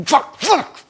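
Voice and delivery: high, mock-furious "Fuck, fuck!" in a put-on voice; imitated angry swearing. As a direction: spit it out like she does at the gutter. Girlfriend swearing at gutter ball.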